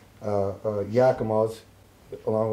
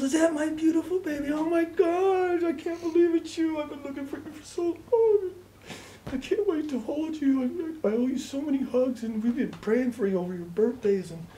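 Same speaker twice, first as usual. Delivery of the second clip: imitated tearful mother. Mother finally hears her lost son, imitated tearful joy. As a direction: wail it tenderly in a high, tearful mock-mother voice.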